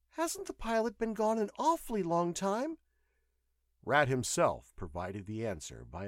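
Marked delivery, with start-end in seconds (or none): 0.1-2.8 high-pitched female voice, mildly worried; anxious young woman. A narrator's reading. Patient asks about the missing pilot, anxious. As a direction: ask it high, with some unease.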